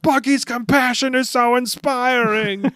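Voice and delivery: hammed-up admiration, high, loud and slow; mock gushing. The whole crew admires Buggy's compassion, mock gushing. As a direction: gush in a put-on high voice.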